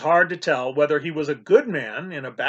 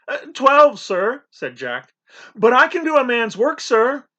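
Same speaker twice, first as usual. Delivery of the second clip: put-on higher, louder young voice; nervous eager boy; 8 semitones higher, 5 dB louder than usual. A narrator's reading. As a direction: pipe up bravely despite fear. Boy Jack nervously answers.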